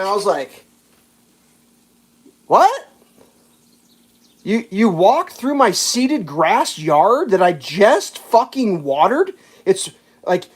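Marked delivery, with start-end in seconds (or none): 2.5-9.3 high yelped question, then slow disbelief; indignant, drawn-out retold disbelief; acted incredulity. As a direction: spell out the outrage slowly, acting it out. Acted shock at driver's trespass.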